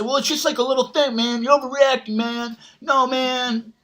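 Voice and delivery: high, whiny brush-off voice; dismissive pushy guy. Retold or imitated. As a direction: play the pushy guy waving off the complaint. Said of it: "And then you say".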